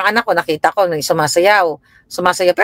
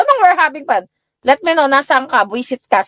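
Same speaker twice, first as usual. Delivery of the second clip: annoyed scolding; 7 semitones higher than usual. Retold or imitated. Mother demanding, retold.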